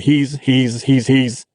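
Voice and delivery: a lightly voiced teacher tripping on he's, he's; teacher at a loss for words, stuttering he's. A narrator's reading. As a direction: stumble over he's, he's, stuck on the word. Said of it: "Mister P stuttered".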